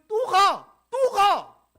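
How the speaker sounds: the insistent God shouting at Moses to go again; shouted command. Acted. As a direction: bark it twice as God, full voice.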